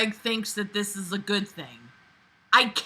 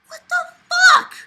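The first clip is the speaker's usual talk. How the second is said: much higher and louder crying shout; shrieking outrage; 9 dB louder than usual. Real, not acted. Reader outraged by the story, shrieking. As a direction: shriek it at the page. Reacting to a shocking story line.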